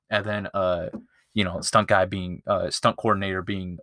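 normal talking voice

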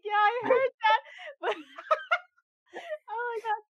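laughing hard, voice high and cracking; can barely get it out for laughing. Real, not acted. Laughing retelling street encounter.

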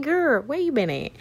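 lightly put-on nosy girlfriend voice; questioning listener. Listeners asking the host where she has been, playfully questioning.

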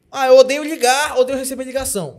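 loud, high whiny complaint; whiny client voice. Retold or imitated. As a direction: whine it like a fussy client. Quoting clients hating calls.

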